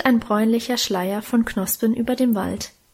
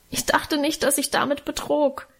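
lightly voiced tearful boy, pitched higher; tearful protest; 5 semitones higher than usual. A narrator's reading. Karl defends himself to his parents, mildly tearful. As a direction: plead innocence with a touch of tears, higher. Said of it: "beteuerte Karl weinend".

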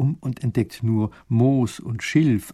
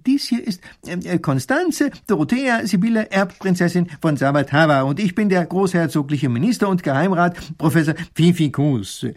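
high, ceremonious introduction of titles; self-important minister; 7 semitones higher than usual. A narrator's reading. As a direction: announce the titles with great dignity. Minister announces titles pompously.

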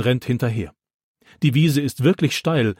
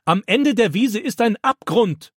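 urgent warning; 10 semitones higher than usual. A narrator's reading. Opa warning of cliff.

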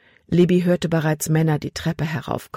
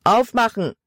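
raised, demanding man's call; sharp order at a higher pitch; 6 semitones higher than usual. A narrator's reading. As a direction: demand entry through the door. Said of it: "rief ein Mann".

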